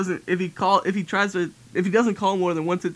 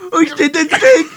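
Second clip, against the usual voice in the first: louder and higher panic; hammed-up startled yelp; mock alarm; 10 semitones higher, 9 dB louder than usual. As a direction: yelp it as the phone rings. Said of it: "He's like".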